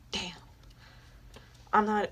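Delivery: usual speaking voice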